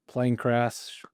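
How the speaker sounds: usual speaking voice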